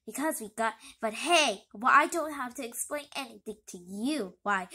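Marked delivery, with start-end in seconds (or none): none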